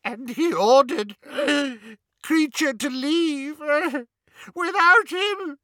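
very high, slow wail between rasping sobs; sobbing house-elf. A narrator's reading. Elf recounting his master's death, racked with sobs. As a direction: gasp it out phrase by phrase, crying. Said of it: "Kreacher's sobs came in great rasps now".

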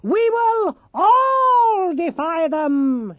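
very high, slow, loud gnome shout; shouting Nicodemus. Acted. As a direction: bellow it like a rallying cry. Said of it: "he shouted".

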